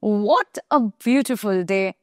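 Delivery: very slow, savoring what; slow exclamation demo. Shown as a demonstration. Teacher showing an exclamation to students, very slow. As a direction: draw out the exclamation. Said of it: "যেমন আমরা বলি না".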